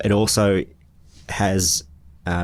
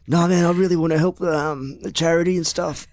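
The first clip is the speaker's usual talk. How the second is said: high, wheedling con man voice; sneaky voice; 12 semitones higher than usual. Retold or imitated. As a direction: sweet-talk them in a high sly voice. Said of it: "that's my sneaky voice".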